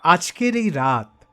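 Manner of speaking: ordinary delivery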